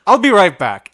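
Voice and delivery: I'll be right back, said loud and clipped. Retold or imitated. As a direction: shut him down before he speaks. Cutting off a returning customer.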